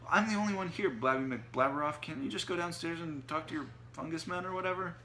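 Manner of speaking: high, loud, exasperated complaint; annoyed retort. A narrator's reading. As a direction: fire back at the muttering housemate. Sushi quarrels with Otto, annoyed.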